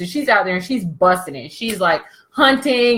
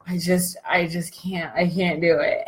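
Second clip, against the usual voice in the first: feeble whisper; 4 semitones lower, 6 dB quieter than usual. Retold or imitated. Weak man quoted, whispered.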